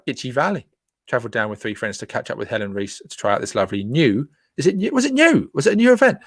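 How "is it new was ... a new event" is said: genuinely puzzled, asking again and again; surprised doubt. Real, not acted. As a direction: pitch jumping much higher with each question. Doubts if event is new.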